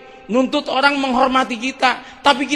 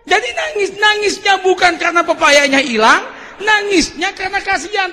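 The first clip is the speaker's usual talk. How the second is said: higher, louder, proclaiming the moral; preaching shout; 9 semitones higher, 3 dB louder than usual. Acted. Preacher revealing why Pak Sahlun wept, shouting it out. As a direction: drive the lesson home with force.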